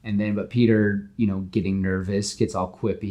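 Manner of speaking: usual speaking voice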